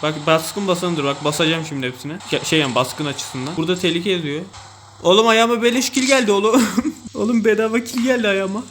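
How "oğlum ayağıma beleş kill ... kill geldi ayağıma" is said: high and loud, laughing through the words; laughing with glee. Real, not acted. Gamer laughing to viewers about a free kill, gleeful. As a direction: gloat over a free kill.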